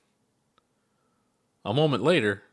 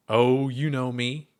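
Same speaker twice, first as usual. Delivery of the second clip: witty; 3 semitones higher, slower than usual. Acted. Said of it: "Ponder gave a witty smile".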